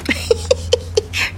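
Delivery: airy high giggle. Acted. Mother laughs at masked medics.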